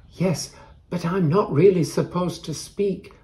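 usual speaking voice

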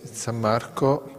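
normal talking voice

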